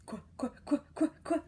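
"Qu, qu, qu" quacked like a duck. Shown as a demonstration. Teacher models qu like a duck for kids. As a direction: quack the sound. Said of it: "like a duck".

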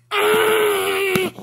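long, high, loud cry; pained scream. Acted. Mouse stuck in the trap, screaming in pain. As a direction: yell out in pain, holding it.

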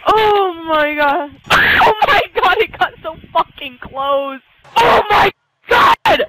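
slow, high, loud wailing; drawn-out frustrated shouts; anguished disbelief. Real, not acted. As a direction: howl over the near miss. Missed a close goal.

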